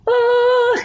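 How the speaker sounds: very high sung oh; high-pitched demo oh. Shown as a demonstration. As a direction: squeeze out a high oh. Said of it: "So when someone goes".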